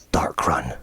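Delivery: low, whispered mutter; hushed, deep grumble to himself. Acted. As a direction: mutter it under your breath, very low. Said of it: "hear the man muttering".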